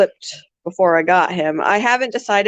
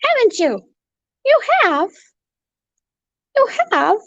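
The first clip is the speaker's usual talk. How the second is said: very high cooing pet talk. Real, not acted. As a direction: coo praise at the parrot.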